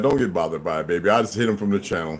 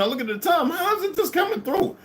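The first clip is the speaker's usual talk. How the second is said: excited. Retold or imitated. Retelling the confusing phone call.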